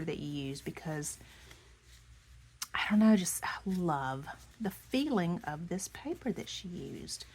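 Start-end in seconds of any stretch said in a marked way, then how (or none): none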